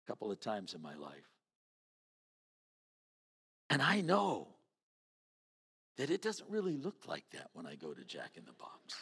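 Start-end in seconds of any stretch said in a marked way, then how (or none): 0.0-1.3 low muttered aside. Retold or imitated. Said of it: "and I'm going". 6.0-8.9 a real whispered aside. Real, not acted.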